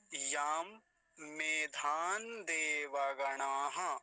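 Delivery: मेधान with a clear n before देवगणाः; nasal as N. Shown as a demonstration. Teacher showing the allowed n variant, slow chant-like syllables. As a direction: draw it out slowly, ending मेधान on n. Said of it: "नकार भी बोल सकते हैं".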